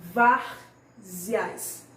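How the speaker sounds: "várzeas" split, heavy stress on VÁR; stressed-syllable demo. Shown as a demonstration. Teacher shows pupils the tonic syllable, stress on VÁR. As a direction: punch VÁR, pause, then let "zeas" follow. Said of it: "aquela sílaba que vem mais forte na nossa pronúncia".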